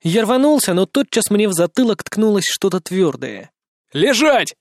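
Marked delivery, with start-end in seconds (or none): 3.9-4.5 harsh barked command; growled order. Acted. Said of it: "прорычал".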